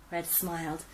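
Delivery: ordinary delivery, nothing marked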